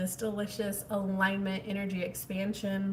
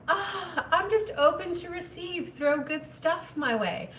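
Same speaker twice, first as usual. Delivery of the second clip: higher, airy mock quote; mock passive dreamer; 7 semitones higher, slower than usual. Mimicking a viewer drifting along, mock dreamy. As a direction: play the passive person lightly. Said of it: "that means you're in a very passive state".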